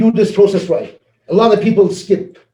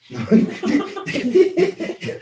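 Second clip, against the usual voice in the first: a high, real burst of laughter; 12 semitones higher than usual. Real, not acted. A manager telling a story about being overpaid, high real laugh.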